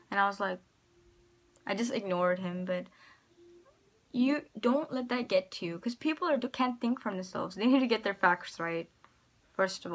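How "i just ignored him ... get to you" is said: usual speaking voice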